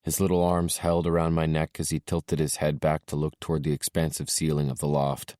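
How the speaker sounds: ordinary delivery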